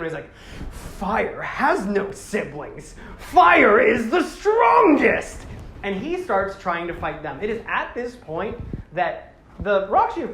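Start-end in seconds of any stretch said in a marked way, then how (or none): 0.7-5.5 slow, drawn-out boast that swells into a high, loud shout; villain boast. Retold or imitated. Retelling the movie villain, slow boast rising to a shout. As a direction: play the fire villain declaring his power.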